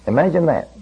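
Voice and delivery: usual speaking voice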